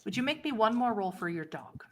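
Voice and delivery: ordinary delivery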